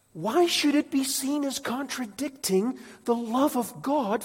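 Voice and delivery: high, loud impassioned preaching; preaching at full force. Acted. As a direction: preach it with rising fire.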